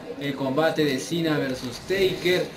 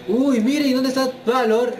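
higher, louder surprised shout; excited; 9 semitones higher, 5 dB louder than usual. Real, not acted. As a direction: yell out at the surprise run-in. Sudden run-in during match.

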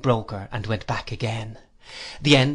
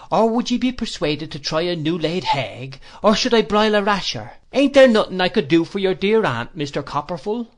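higher, louder wheedling Cockney landlady; fawning landlady; 6 semitones higher, 5 dB louder than usual. A narrator's reading. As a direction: gush to win favour. Mrs Crupp fawns over aunt.

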